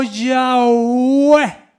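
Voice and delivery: "Yahweh" stretched long, as "yaaa-OOO-eh"; drawn-out Yahweh. Shown as a demonstration. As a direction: sound out "Yahweh" slowly, gliding through a long "oo" into "eh". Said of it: "la pronunciación era más o menos así".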